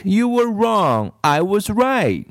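put-on high, slow, smug little-girl gloat; triumphant bratty child, slow and high. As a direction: rub it in like a know-it-all child. Kathy voiced high.

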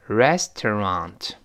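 "restaurant" drawn out, every syllable full and clear; slow syllable-by-syllable. Shown as a demonstration. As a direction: model "restaurant" very slowly, final t sounded. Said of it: "我们慢慢读".

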